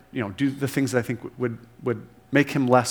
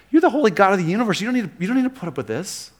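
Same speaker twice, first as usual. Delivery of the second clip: incredulous outburst; 6 semitones higher, much faster than usual. Pretend. Preacher imagining telling God he needn't put up with them, in a fast incredulous outburst. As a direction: blurt it out in disbelief.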